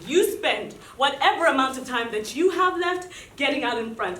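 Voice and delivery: normal talking voice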